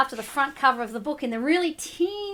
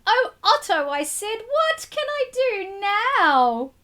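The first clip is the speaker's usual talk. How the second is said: put-on despairing boy; 7 semitones higher than usual. A narrator's reading.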